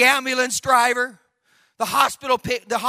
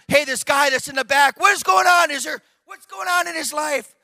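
raised, higher baffled questioning; crying out; 5 semitones higher, 3 dB louder than usual. Retold or imitated. Ambulance drivers asking about man in back, alarmed cry. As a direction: call out, alarmed and puzzled. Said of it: "they cried out".

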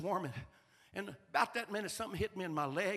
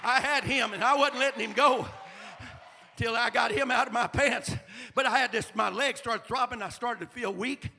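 rising, louder sermon voice; preaching; 6 semitones higher, 10 dB louder than usual. Acted. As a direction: preach it out with fire. Preaching full force.

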